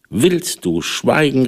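slow, high, threatening snap; angry cry. A narrator's reading. Cat silences the accusing mouse with a slow threatening cry. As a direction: cut the mouse off, menacing. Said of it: "rief die Katze".

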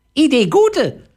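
very high squawky parrot talk. Acted. Parrot answering the boy in a high squawky voice. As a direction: chirp it out like a little parrot.

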